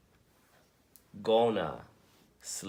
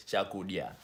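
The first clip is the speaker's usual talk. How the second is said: Chakudya with a quick, short final dya; Chakudya, softer, ending said fast as one syllable; faster at the end; 2 semitones lower, 5 dB quieter than usual. Shown as a demonstration. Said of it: "But say it faster at the end".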